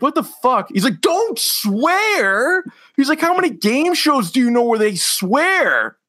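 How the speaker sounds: high, strained mock outrage; hammed-up high indignant host; angry host impression. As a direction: play the furious game show host.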